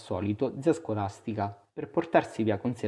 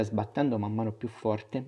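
ordinary delivery, nothing marked

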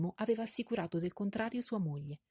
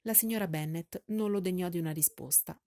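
ordinary delivery

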